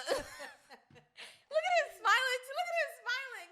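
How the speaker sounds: high, loud, slow laughing delight; laughing squeal. Real, not acted. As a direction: squeal it through giggles. Laughing at co-host smiling.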